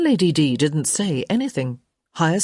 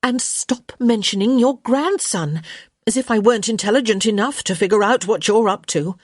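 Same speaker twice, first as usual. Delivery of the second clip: higher, quickening, indignant rebuke; exasperated young Hyacinth; 6 semitones higher, 4 dB louder than usual. Acted. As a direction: tell the meddler off briskly.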